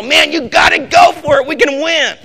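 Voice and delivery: a relay runner out of breath, cheering on his partner; breathless cheer. Pretend. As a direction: push your partner with a shout.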